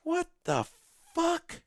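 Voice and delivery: high, stunned what the fuck; baffled outburst. Real, not acted. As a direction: gasp it out in disbelief.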